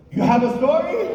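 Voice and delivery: high, loud disbelieving mother; mock a mom who can't believe it; incredulous mom. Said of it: "She said".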